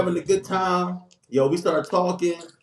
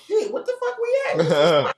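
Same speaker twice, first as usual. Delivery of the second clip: bewildered; 7 semitones higher than usual. Retold or imitated. Confused at after-hours spot.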